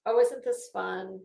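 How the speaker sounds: usual speaking voice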